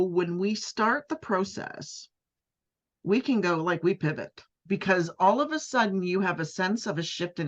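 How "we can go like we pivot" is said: normal talking voice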